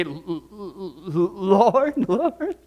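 stuttering "L-, l-, l-" slowly, then a rising "Lord"; a terrified sinner stammering "L-, l-, l-, Lord"; stammering dread. Acted. Condemned stammering before God.

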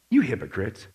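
low, softened, deadpan rebuke. Acted. Voicing Jesus's rebuke, first take.

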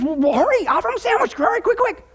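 high-pitched mock urgency; frantic urging. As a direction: hurry him along in a high voice. Acted frantic order in joke.

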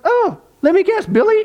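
high, knowing "Billy?"; teasing guess. Retold or imitated. Church ladies teasing about weekly flowers, high and knowing. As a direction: tease him with a knowing guess.

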